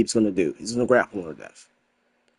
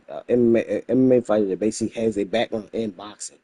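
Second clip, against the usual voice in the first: ordinary talk, nothing marked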